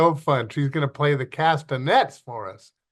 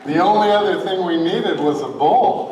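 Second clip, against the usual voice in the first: laughing; 5 semitones higher, 3 dB louder than usual. Real, not acted. Pastor laughing after the act.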